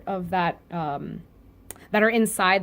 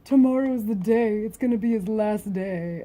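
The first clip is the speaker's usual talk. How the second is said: imitating a boastful rival, pitched up a little; mock bragging; 3 semitones higher than usual. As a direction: put on a bragging character's voice. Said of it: "is still bragging".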